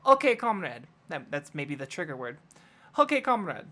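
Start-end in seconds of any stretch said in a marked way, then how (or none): 0.1-0.9 raised-pitch mock Russian accent. Shown as a demonstration. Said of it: "But Russian?". 3.0-3.7 raised-pitch mock Russian accent. Shown as a demonstration. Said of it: "But Russian?".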